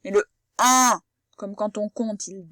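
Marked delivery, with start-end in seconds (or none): 0.0-1.0 "un" said with the chin lowered, rounded. Shown as a demonstration. Teacher showing the sound "un" to a child. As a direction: drop the chin on "un". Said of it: "on fait descendre son menton".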